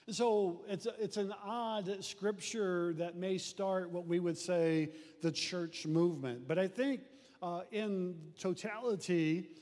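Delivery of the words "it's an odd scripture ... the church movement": normal talking voice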